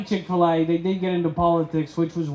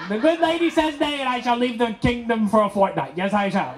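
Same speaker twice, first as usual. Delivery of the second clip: hammed-up medieval voice, higher; lightly hammed courtly exit; mock courtly knight; 5 semitones higher than usual. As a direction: proclaim like a jilted knight.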